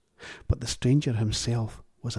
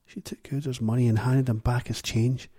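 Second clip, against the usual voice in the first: usual speaking voice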